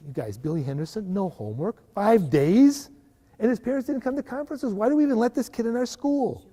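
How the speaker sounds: a teacher sneering at a student; dismissive, judgmental tone. Shown as a demonstration. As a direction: run the kid down to the colleagues. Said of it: "It's the tone of my voice is different in those".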